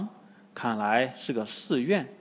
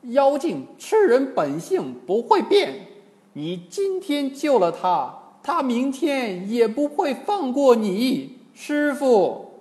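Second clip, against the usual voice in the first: higher, pleading with master; higher earnest monkey voice; earnest Monkey King; 10 semitones higher, 4 dB louder than usual. Acted. Wukong pleads with his master.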